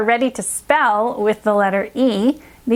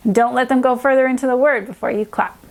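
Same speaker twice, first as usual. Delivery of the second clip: normal talking voice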